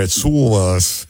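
"sauce" stretched into "soo-aw-us"; mock three-syllable "sauce". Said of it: "three syllables in there".

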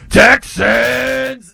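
loud, high arena announcer call; booming stadium PA yell; stadium announcer shout. Retold or imitated. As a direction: bellow it like the PA guy hyping the crowd. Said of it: "the guy in the loudspeaker just goes".

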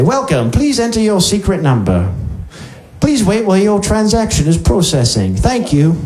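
prim automated British voice; phone menu voice. Retold or imitated. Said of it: "a woman with a British accent".

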